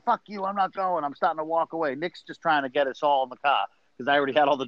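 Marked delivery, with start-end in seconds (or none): 0.0-1.0 raised pitch, a mild retold blowup; mock angry outburst. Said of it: "I'm flipping out, saying".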